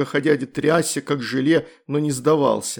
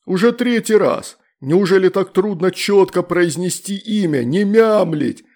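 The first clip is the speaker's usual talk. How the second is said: higher, louder furious monster scolding; angry demon; 5 semitones higher, 5 dB louder than usual. A narrator's reading.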